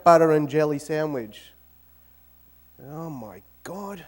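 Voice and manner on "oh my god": slow, soft, worn-out "oh my God"; dragged-out, quiet despair; weary groan. Acted. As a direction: sigh it out like a man sick of his lunch. Fed-up husband opens lunchbox.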